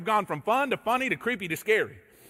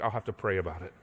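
mock pious; 13 semitones lower, 5 dB quieter, faster than usual. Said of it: "as a very spiritual leader of my home".

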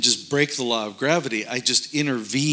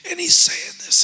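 ruined voice; 7 dB louder than usual. Retold or imitated. Imitating strained voice mid-story.

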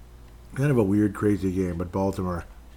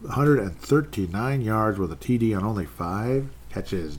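ordinary delivery

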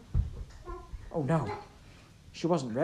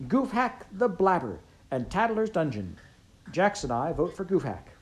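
higher, eager pitch; suddenly keen; perking up; 7 semitones higher, 4 dB louder than usual. A narrator's reading. Pickett perking up to ask for his favourite story, eager. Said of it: "perking up".

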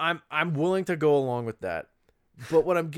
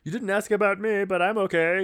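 higher, mildly louder droid impression; C-3PO; 5 semitones higher, 5 dB louder than usual. C-3PO interrupting the crew to report on himself, high imitation. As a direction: pipe up as the overlooked droid. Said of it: "and then C three P O goes".

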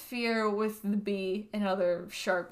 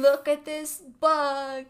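imitating someone; 3 semitones higher, 3 dB louder, slower than usual. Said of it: "They'd be like".

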